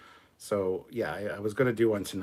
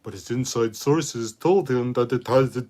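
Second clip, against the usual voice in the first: slow, loud parody of a rival youtuber; mock Doomcock voice; 3 semitones higher, 9 dB louder than usual. Streamer mocks a rival youtuber in a slow loud parody voice. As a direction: ham up a pompous rival, drawn out.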